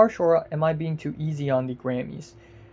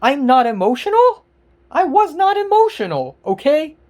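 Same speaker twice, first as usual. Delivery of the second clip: louder, higher, indignant ranting; real exasperated outburst, building to a near-yell; angry rant; 5 semitones higher, 5 dB louder than usual. Real, not acted. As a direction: shout your disbelief at the commenters. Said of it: "I know I seem really angry right now".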